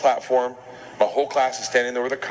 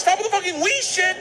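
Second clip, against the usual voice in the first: exasperated yell. Retold or imitated. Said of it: "I'm like".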